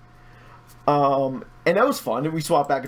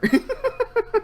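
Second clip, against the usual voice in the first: a short laugh shooting far up; high amused laugh. Real, not acted. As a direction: laugh at her line, delighted. Laughs at fiancee's remark.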